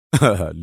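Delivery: short, low amused laugh; a brief deep chuckle. A narrator's reading. Agent amused at his angry partner, low short laugh. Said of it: "ضحك وهو يقول".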